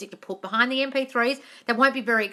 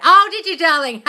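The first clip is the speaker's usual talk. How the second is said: much higher and louder, gushing; delighted; 7 semitones higher, 11 dB louder than usual. Real, not acted. Host reacting to a viewer's podcast vision, delighted. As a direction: gush with surprise at the news.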